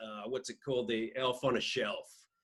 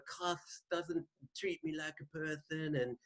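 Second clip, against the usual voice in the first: soft lisping boxer; 3 semitones higher, 7 dB quieter than usual. Retold or imitated. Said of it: "I'm sounding more like [name]".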